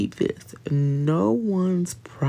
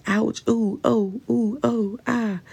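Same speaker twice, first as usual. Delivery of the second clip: higher, hammed-up little ouches; mock pained moaning; 7 semitones higher than usual. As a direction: fake a string of whiny groans. Imitating constant pained groaning.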